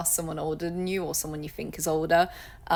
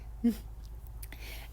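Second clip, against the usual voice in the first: soft laugh; 4 semitones higher, 9 dB quieter than usual. Real, not acted.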